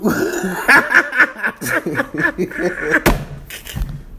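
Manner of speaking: high, loud burst of laughter; big laugh. Real, not acted. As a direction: crack up laughing. Brother recalls the whooping.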